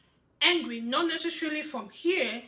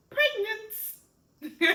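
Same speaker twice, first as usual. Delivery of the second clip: high-pitched, gleeful announcement; playful excited reveal; 9 semitones higher than usual. Shown as a demonstration. Signing emotional example sentence, elated.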